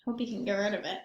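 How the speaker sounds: usual speaking voice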